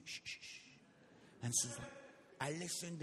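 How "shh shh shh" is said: soft voiceless shushes; hushing. Retold or imitated. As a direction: shush someone like God quieting a child. God silences him, fingers on lips.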